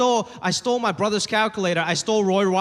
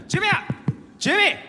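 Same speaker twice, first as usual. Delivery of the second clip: high-pitched mock yell through a door; imitating an irate father; screaming angry dad; 5 semitones higher than usual. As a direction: order your kid out, pitched high. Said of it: "he was screaming".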